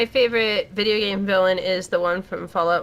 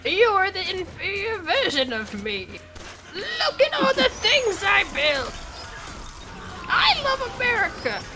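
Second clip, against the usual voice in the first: hammed-up ominous villain; 10 semitones higher than usual. Player mocking the game villain's taunts, hammed-up ominous voice. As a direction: crow it like a high-voiced prospector baddie. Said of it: "like, ominous voice stuff".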